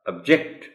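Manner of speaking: Object as verb, weak first vowel, stressed JECT; object with the stress moved onto the J; stress on second syllable. Shown as a demonstration. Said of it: "इसका pronunciation हो जाएगा".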